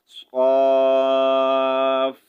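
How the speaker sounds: صاف with the long aa stretched very slowly, then a light fa at the end. Shown as a demonstration. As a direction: Quran teacher stretching the madd in صاف. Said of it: "جب ہم صاد کو stretch کریں گے".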